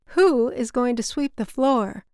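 a hint of the mother scolding; mother's voice. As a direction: daughter imitating her mother's nag. Daughter voices her mother.

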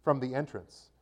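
steady, measured Bible reading voice; scripture reading. A narrator's reading. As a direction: read the Gospel to the congregation, clearly. Reads the Gospel aloud.

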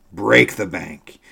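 loud, slow, with "Break" hit hardest; loud urging. A narrator's reading. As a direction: urge him on, stressing "Break". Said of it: "cried my military friend".

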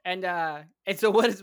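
ordinary talk

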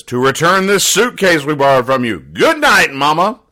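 high, loud, hammed-up announcement; loud punchline delivery. Son returns a borrowed suitcase to Mama, loud and hammed-up. Acts Thomas's punchline and farewell.

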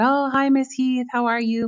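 bright, lifted hello to a teacher; principal's cheery greeting. Retold or imitated. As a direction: greet her brightly across the gate. Principal greeting her at gates.

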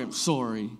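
put-on low, grudging child's sorry; mock-heartfelt apology. Child made to apologise by Mom and Dad, mock-heartfelt. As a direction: drop it into an insincere apology. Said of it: "it was real heartfelt".

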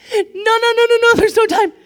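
fighting back tears, pleading no time to cry; real tears rising, high and strained; choked up. Real, not acted. Overcome speaking of God's goodness.

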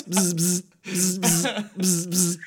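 low, soft imitated phone buzzing; vibrating phone buzz. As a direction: mimic a phone vibrating quietly. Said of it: "going off like a vibrator".

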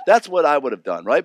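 ordinary talk, nothing marked

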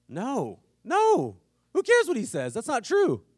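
put-on high, loud indignant kid voice; a kid squealing no no, who cares; child's indignant reply. Pastor voicing a kid denying the name, high and loud.

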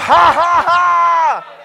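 big, high, shouted "ha, ha, ha"; deliberate loud laugh. Real, not acted. Preacher laughing because she's healed, loud deliberate laugh. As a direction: belt out a triumphant laugh. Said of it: "I'm gonna laugh".

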